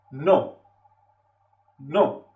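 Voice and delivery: sharp falling no, a bit annoyed; a touch of annoyance, falling tone; curt angry no. Shown as a demonstration. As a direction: snap a flat refusal downward. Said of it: "hay que estar un poco enfadado".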